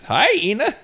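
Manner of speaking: very high greeting into a long cackle; hyena laugh greeting. Retold or imitated. Teenager greeting the cook with a pun, hyena laugh. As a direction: squeal the hello, then cackle like a hyena. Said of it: "this is how dumb I am".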